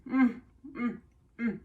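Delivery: pleased humming while chewing; savoring mmm. Real, not acted. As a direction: hum with delight at the taste. Savoring a bite.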